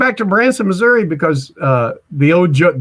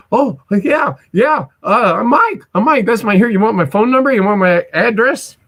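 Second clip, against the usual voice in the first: flustered excitement; 7 semitones higher than usual. Retold or imitated. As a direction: blurt it out like a fan meeting a star. Starstruck reply to [name].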